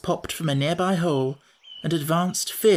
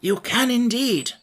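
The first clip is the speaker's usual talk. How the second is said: higher, eager and drawn out; eagerly; 8 semitones higher than usual. Acted. Scarecrow to the mouse queen, eagerly higher. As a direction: jump at the offer. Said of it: "replied the Scarecrow eagerly".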